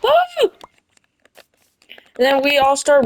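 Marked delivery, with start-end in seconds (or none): 0.0-0.5 very high squealed oh; a sudden shocked squeal; mock gasp. Kids seeing the mustard monster, very high squealed gasp. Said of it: "Then they're like".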